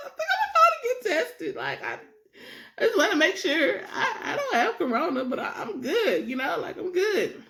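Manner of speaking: laughing nervously through it. Retold or imitated. Telling the manager she'll get tested, laughing nervously. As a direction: laugh it off while scared.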